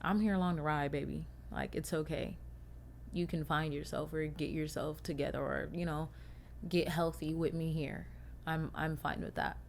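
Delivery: soft, low and gentle, quoting her man; quiet reassuring boyfriend; tender. Retold or imitated. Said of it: "my man being like".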